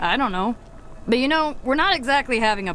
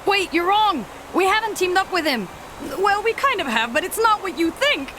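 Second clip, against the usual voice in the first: higher-pitched, anxious objection; urgent protest; 8 semitones higher than usual. Acted. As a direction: protest quickly before being cut off. Protests as the other refuses to listen.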